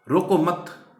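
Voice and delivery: रोको मत as one loud, high phrase; pause after मत. Shown as a demonstration. Teacher showing the second comma position, stop after मत. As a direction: punch रोको मत together, then stop after मत. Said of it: "उसके बाद comma लगा दी".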